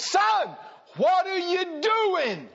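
high, drawn-out shouted demand; loud acted confrontation, slow and insistent; exasperated shout. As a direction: call him out, stretching every word. Retold pastor confronts young man.

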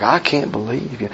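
mock disbelief. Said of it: "an attitude like".

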